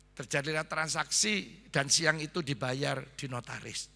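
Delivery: ordinary talk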